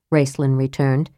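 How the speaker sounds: plain reading voice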